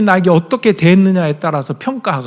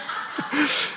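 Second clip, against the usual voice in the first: breathy laugh; 11 semitones higher, 9 dB quieter than usual. Real, not acted. Laughs at his daughter's joke.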